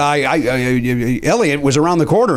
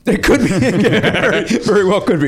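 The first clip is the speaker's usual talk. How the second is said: laughing; 6 semitones higher, slower than usual. Real, not acted. Laughing at the joke.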